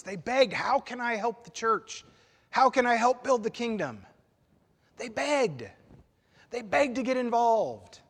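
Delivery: eager begging. Acted. Preacher acting out eager begging.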